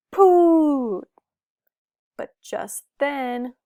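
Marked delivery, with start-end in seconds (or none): 0.1-1.0 loud puff of wind. A narrator's reading. Ball kicked.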